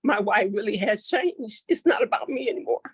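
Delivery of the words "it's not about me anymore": higher and faster, emotion breaking through; real tearful rush of words; choked up. Real, not acted. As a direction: let the feeling spill over. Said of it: "that's why I get so emotional".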